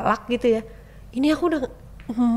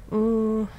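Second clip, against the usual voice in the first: usual speaking voice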